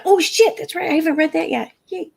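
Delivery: high, startled "oh shit", then a mock "yay"; sudden realisation. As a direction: replay the moment it dawned on her. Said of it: "I'm like".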